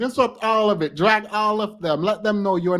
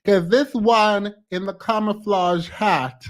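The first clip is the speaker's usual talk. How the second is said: ordinary talk, nothing marked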